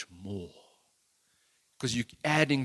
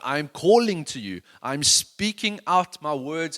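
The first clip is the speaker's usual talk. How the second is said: slow, drawn-out calling words; 3 semitones higher, 8 dB louder, slower than usual. Shown as a demonstration. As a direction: call it out slowly, word by word. Preacher calling out slowly.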